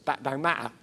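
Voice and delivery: quiet, put-on Cockney accent; Rose's line in a mock Cockney accent; false Cockney. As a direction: drop into a fake Cockney accent for Rose. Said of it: "her sort of false Cockney voice".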